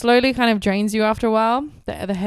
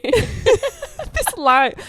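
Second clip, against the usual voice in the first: laughing; 11 semitones higher, 3 dB louder than usual. Real, not acted. Laughing at her own lie.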